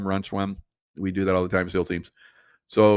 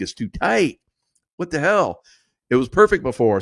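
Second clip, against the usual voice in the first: annoyed surprise; 5 semitones higher, 4 dB louder than usual. Retold or imitated. Annoyed by tight mask mid-swim.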